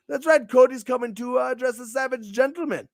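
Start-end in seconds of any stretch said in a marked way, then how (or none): none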